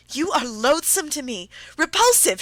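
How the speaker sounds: high, loud, shrieking disgust. A narrator's reading. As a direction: hurl the word repulsive at him. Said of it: "she shrieked".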